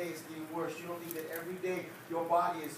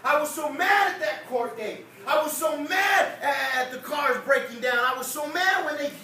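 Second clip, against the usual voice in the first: much higher and louder, shouting; preaching full force; 7 semitones higher, 7 dB louder than usual. Real, not acted. Preacher confessing anger to the church, shouting. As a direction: build it up into a shout at the Lord.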